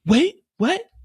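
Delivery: high, loud wait what; shocked. Acted. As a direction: jolt up in disbelief. Mireya shocked.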